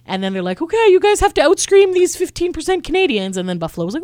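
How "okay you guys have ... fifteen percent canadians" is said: high, loud stage challenge; playful hype, pitched way up; mock crowd rallying. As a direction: imitate a singer egging on an audience. Imitates singer rallying the crowd.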